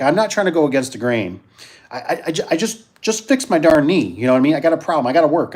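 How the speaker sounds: ordinary delivery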